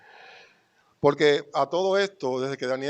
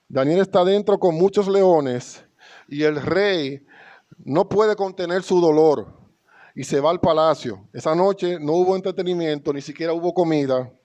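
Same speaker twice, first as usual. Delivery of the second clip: louder, raised preaching voice; preaching with force; 3 semitones higher, 5 dB louder than usual. Acted. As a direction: drive the story home to the church. Predicación enfática. (predicación enérgica)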